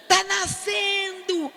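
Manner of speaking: high, drawn-out cry of alarm; alarmed shout. Retold or imitated. Nurse to the room, slow high alarmed shout. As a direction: yell it out slowly, high and alarmed.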